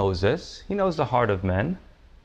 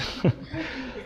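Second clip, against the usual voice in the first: quiet, high little laugh; amused lingering chuckle; soft chuckle; 9 semitones higher, 6 dB quieter than usual. Real, not acted. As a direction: let a soft chuckle slip out. Still laughing at own impression.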